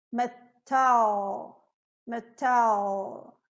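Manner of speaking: "Metal" with a held dark L; dark L ending. Shown as a demonstration. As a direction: draw "Metal" out into a dark final L. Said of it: "é um dark L". ("Metal" com L escuro no final)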